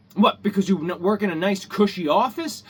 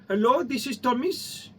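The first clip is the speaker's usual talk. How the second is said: higher-pitched imitation of an Indian accent; mock Indian accent; 5 semitones higher than usual. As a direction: put on a tech-support Indian accent. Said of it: "forgive the accent".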